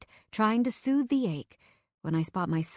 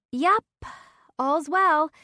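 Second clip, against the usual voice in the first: much higher and louder, chirpy; tipsy breezy; 9 semitones higher, 6 dB louder, much slower than usual. Acted. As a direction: fake breezy, buzzed. Tipsy girl texting breezily.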